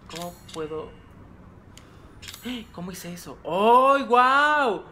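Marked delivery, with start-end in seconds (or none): 1.0-2.7 sharp unvoiced gasp of fright; a startled breath in. Real, not acted. Se asusta jugando terror. 3.4-4.8 loud high cry of wonder; ay, guau blurted out in amazement; surprised. Real, not acted. Reacción sorprendida al juego.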